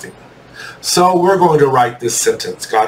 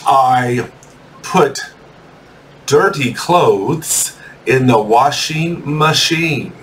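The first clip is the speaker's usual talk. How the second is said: word by word with long pauses; slow dictation; slower than usual. Shown as a demonstration. Teacher giving students a sentence to write, slow dictation. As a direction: dictate slowly, leaving gaps for writing.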